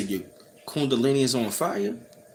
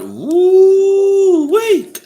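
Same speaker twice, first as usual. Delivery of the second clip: excited whoop; 10 dB louder than usual. Real, not acted. Exclaims at ringing.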